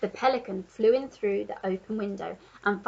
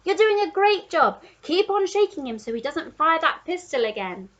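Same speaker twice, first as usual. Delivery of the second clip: higher cheering giraffe; Giraffe encouraging; 6 semitones higher, 4 dB louder than usual. A narrator's reading. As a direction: encourage him eagerly. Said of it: "cried the Giraffe".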